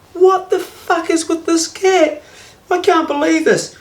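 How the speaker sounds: high, slow, disbelieving rant; exasperated outburst. Retold or imitated. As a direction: throw your hands up at the cat. Retold outburst at the cat.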